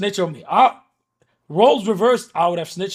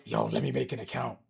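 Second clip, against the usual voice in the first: sneaky hush; 6 semitones lower, 8 dB quieter than usual. Retold or imitated. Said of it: "I'm like".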